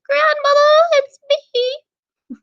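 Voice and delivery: very high squeaky fake girl voice; wolf pretending to be a little girl, very high; squeaky girly voice. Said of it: "in his best little girly voice".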